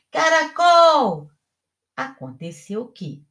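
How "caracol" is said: slow, loud, high calling shout; drawn-out yell. A narrator's reading. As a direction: call it out long and loud. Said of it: "ia berrando".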